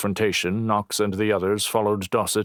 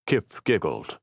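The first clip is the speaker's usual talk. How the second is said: ordinary delivery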